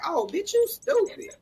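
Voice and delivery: high, drawn-out exclamation of disbelief; mock disbelief. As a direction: stretch it out high, retelling your reaction. Acting out own shocked reaction.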